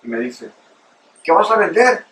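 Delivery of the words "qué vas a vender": higher, louder vendor lady's voice; imitating vendor lady. Hot dog vendor questions him.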